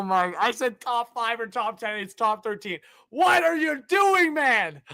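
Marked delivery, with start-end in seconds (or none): 3.1-4.8 exasperated shout. Real, not acted. Addressing the team's management.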